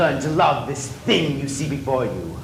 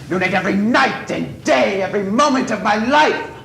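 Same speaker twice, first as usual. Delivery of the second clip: rising anguished yell; 5 semitones higher, 4 dB louder than usual. Acted. Eric's rage over his face.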